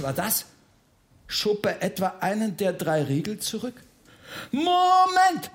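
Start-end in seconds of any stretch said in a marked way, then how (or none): 4.5-5.5 high, drawn-out yell. Acted. Bertha stops the Freiherr unbolting the door, yelling. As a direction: yell it to halt someone in their tracks. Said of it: "schrie Bertha".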